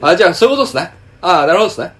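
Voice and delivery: imitating a domineering older coworker; overbearing older man. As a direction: ham up a brusque know-it-all caller handler. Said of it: "すっごい高圧的に喋んのよ".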